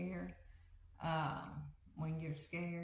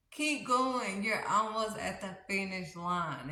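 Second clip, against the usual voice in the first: encouraging shout; 4 semitones higher, 7 dB louder than usual. Acted. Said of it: "And he's like".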